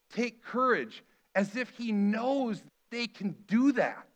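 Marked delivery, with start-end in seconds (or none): 0.1-1.0 mild mock yell. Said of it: "He yells at them".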